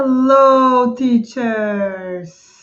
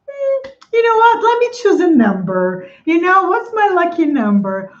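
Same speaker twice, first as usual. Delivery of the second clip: higher-pitched mock musing; mock pondering; 6 semitones higher than usual. Host mocks making up income figures, mock pondering. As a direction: pretend to pick a number on a whim. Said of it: "just came up with something like".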